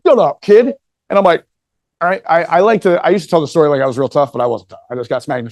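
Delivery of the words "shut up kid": loud, raised acted put-down; retold dismissive command. Eighteen-year-old boy to the six-year-old, loud acted dismissal. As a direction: throw it out loud and high. Older boy snapping at the child.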